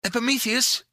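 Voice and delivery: put-on high, urgent girl's call; exclaiming. A narrator's reading. Pandora calls to Epimetheus, exclaiming. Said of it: "exclaimed Pandora".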